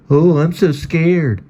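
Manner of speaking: high, slow, sarcastic fake fear; mock scared. Guitar Man sarcastically pretending to be scared of the boy. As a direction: drag it out, mocking.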